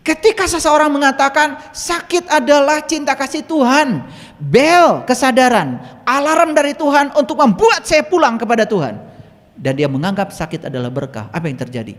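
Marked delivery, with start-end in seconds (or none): 0.0-9.0 high, loud preacher's proclamation; full-force preaching. Acted. Preacher declares sickness is God's loving alarm, full-force preaching. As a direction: ring it out like a sermon.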